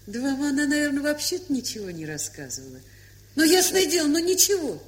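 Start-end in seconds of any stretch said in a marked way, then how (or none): 3.3-4.8 loud brush-off. Acted. Klava brushing off awkward question.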